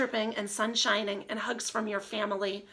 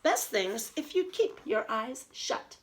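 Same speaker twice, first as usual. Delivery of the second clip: put-on reading to children; slower than usual. Reads story aloud.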